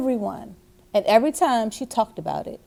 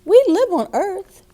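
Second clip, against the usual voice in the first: mother's voice; 9 semitones higher, 4 dB louder than usual. A narrator's reading. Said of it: "her mother said".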